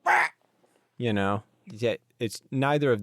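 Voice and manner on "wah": short, loud mock death wah; hammed-up wah death sound; imitated death cry. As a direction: blurt one wah like a character dying. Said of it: "Doraleous will be like".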